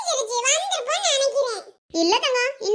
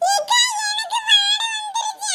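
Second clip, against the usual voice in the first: put-on high, slow whining little girl; whiny child; 8 semitones higher, slower than usual. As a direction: complain like a small child. Child complaining of smell. (கெட்ட வாடைக்குப் புகார் சொல்லும் சிறுமியின் சிணுங்கல்)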